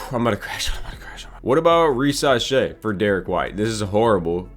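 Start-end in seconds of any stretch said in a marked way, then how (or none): 0.7-1.3 whispered, much softer repeat; tired whispered echo to himself. Real, not acted. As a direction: breathe it out as a whisper. Repeats line under breath.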